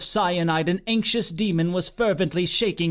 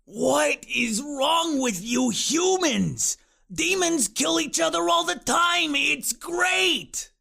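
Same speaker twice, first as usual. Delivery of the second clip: frustrated yell; 9 semitones higher, slower than usual. A narrator's reading. Frustrated demon yells.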